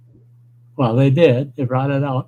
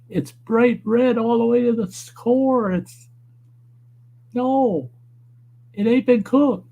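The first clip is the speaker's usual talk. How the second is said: higher, indignant retelling of the complaint; incredulous protest; 8 semitones higher than usual. Retold or imitated. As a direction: insist it's raw, pitched up in disbelief. Retold arguing over raw tuna.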